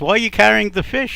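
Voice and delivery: high, loud baffled husband; exasperated question. Acted. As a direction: challenge the wife, exasperated. Husband challenging wife in acted quarrel.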